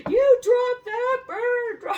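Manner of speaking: very high, loud, drawn-out yell; shrieking at a crow to drop its prey; yelling out the window. Retold or imitated. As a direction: scream it at the crow through the glass. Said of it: "I'm screaming through the window".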